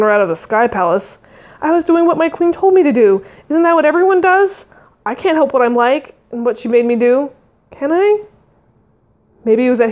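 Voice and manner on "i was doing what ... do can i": higher-pitched anxious justification; defensive pleading. A narrator's reading. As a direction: plead your case, ending on a raised question. Defensive pleading in narrated dialogue.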